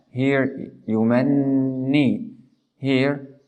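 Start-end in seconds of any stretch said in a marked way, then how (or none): 0.9-2.3 slow "yumanni" with a long nasal n; stressed nun. Shown as a demonstration. Teacher recapping from the beginning, "yumanni" with held n. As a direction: stretch and hum the double n of "yumanni". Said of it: "with stress or emphasizing".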